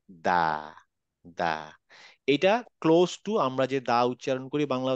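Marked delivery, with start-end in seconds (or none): 0.1-1.7 দা with tongue just under the teeth; tongue on the teeth root for দা; dental da. Shown as a demonstration. Teacher demonstrates to students: dental দা. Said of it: "জিভটা দাঁতের ঠিক নিচে লাগবে".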